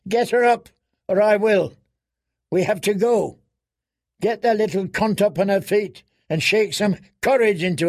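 high, urgent, angry command; fierce woman pushing them to move; threatening order. A narrator's reading. Woman with knife threatens, urging haste.

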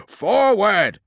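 high, loud battle cry; commanding yell, pitched right up; shouted order. Acted. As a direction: bellow the command to the troops. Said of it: "ordered Keretreus".

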